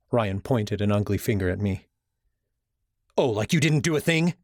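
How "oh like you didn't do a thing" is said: higher sarcastic snap; snapped retort. Acted. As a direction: throw the blame back at him. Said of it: "I snapped back".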